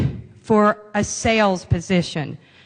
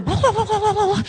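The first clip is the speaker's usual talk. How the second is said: mock sales babble; 10 semitones higher than usual. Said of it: "you just start going".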